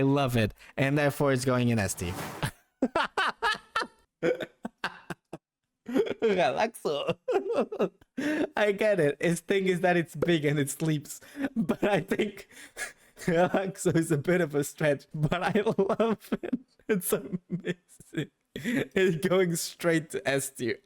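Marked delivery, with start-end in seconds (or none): 2.8-7.9 slow word squeezed out through laughter; laughing. Real, not acted. Streamer reading a silly Pokemon name, laughing. 11.5-20.8 talking through leftover giggles; laughing. Real, not acted. Streamer ranking Relaxo in the top tier, laughing.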